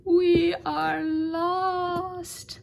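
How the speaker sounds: very high, loud, slow drawn-out wail; despairing wail. A narrator's reading. The townspeople despair at the pasta, long high wail. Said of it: "said the people".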